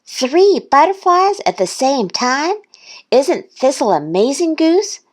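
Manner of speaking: high, amazed duck voice; quacked. A narrator's reading. Duck admiring Thistle to Goose, high amazed quack. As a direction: marvel in a high character voice. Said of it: "Duck quacked".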